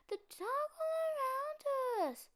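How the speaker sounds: high, slow, near crying; a cub close to tears; tearful little cub. A narrator's reading.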